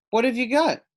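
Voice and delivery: high, loud, eager question; excited child voice; eager kid. Acted. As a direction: ask like a hungry kid at a fridge. Hungry Sophia opens friend's fridge.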